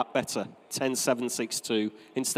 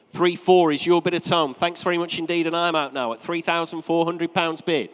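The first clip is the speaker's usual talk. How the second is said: steady, projected saleroom delivery; auctioneer's patter; 4 semitones higher than usual. Real, not acted. Auctioneer to saleroom and home bidders on a new lot, practised patter. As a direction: present the lot, then chant the rising bids.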